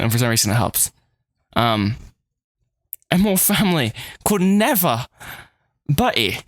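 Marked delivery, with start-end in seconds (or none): 3.1-5.1 higher-pitched acted British accent. Betty protesting about the family, British accent. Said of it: "doing this in a British accent". 5.9-6.4 a short name in a put-on British accent. Jane calling to Betty, British accent. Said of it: "doing this in a British accent".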